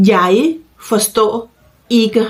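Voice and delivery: slow model phrase with a pause after each word. Shown as a demonstration. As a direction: say each word separately for learners. (พูดช้าๆ ทีละคำ)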